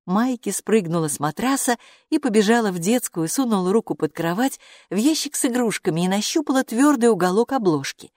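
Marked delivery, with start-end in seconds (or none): none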